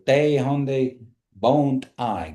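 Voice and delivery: "They honde bont I" said at half speed; careful slow Kiowa phrase; slow Kiowa demo. Shown as a demonstration. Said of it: "they say".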